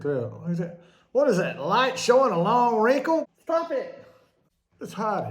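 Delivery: high, loud shriek over a wrinkle; mock horror. Real, not acted. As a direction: squeal in fake alarm at a wrinkle. Spots a wrinkle on camera.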